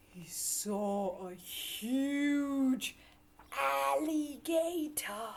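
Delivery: slow, drawn-out reveal; dramatic reveal. A narrator's reading. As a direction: stretch out the big reveal.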